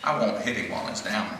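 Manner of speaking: softly spoken, understated humility; humble. A narrator's reading. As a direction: say it humbly and softly, without triumph. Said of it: "heard the new boy humbly saying".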